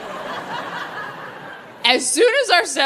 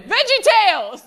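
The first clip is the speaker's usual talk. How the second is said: much higher, blurted panic answer; panicked blurt; 11 semitones higher than usual. Retold or imitated. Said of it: "panicked and went".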